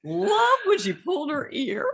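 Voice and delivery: very high put-on comedienne voice; Carol Burnett. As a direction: squeal it up high, straight out of a comic yell. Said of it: "she did that little Tarzan yell".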